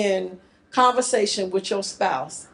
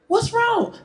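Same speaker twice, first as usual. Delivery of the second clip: high-pitched pleading question; pleading concern; 12 semitones higher than usual. Acted. Husband asks sulking wife, high and pleading. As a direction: pitch it right up, coaxing.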